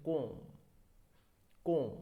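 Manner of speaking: comb said "kohm", no b; silent b. Shown as a demonstration. Teacher demonstrating for students, comb as "kohm" with silent b. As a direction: round the vowel in comb, end on m. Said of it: "वो silent रहता है".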